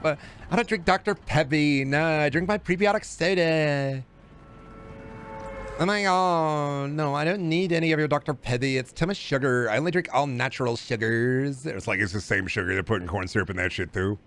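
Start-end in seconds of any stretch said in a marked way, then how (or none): 0.0-11.6 exaggerated girly drawl, vowels stretched. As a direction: whine it like a health-conscious girl.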